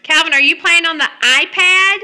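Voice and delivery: ordinary talk, nothing marked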